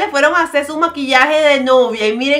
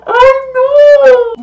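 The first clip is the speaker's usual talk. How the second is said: higher, louder pained ay no; dismayed cry; 10 semitones higher, 6 dB louder than usual. Real, not acted. Vlogger seeing couple stuck together, dismayed cry. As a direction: wail in mock dismay.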